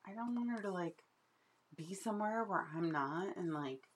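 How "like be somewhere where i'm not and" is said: ordinary talk, nothing marked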